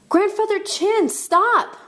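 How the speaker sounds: high shout up to a rooftop. A narrator's reading. Child calls up to grandfather on the roof, urgent high shout. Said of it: "I shouted up to the rooftop".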